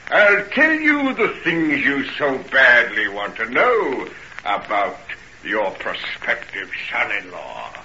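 usual speaking voice

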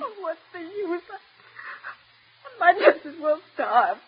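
hushed, tearful despair; soft, broken, defeated sob; crying. Acted. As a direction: give up, voice sinking to almost nothing. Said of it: "stop crying".

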